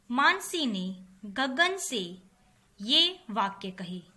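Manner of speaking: normal talking voice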